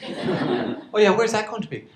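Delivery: amused partner teasing with a laugh. Retold or imitated.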